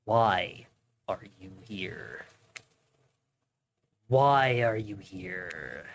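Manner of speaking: low, hushed mysterious voice; ominous game voice. Acted. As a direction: speak like a cryptic spirit. Reads game character lines.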